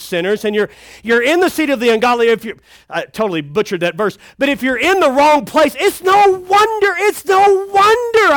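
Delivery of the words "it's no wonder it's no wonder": high, slow preacher emphasis; preaching. Acted. Preacher driving home the point to the congregation, high and slow. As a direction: lean in on the point. Sermon build-up.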